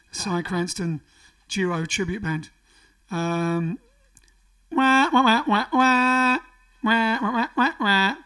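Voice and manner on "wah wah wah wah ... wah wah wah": wah wah sung like backing instruments; vocal backing track imitation. Shown as a demonstration. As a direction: mouth the band's backing line, loud and high. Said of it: "the backing track version".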